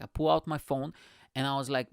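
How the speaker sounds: usual speaking voice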